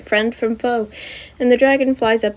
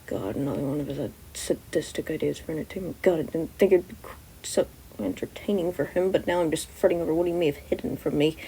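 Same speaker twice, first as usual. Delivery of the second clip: much softer, low inner grumble; inner monologue; 4 semitones lower, 9 dB quieter than usual. A narrator's reading. Aizawa fretting over Nezu's plan, soft low grumbling thoughts. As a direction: grumble to yourself under your breath.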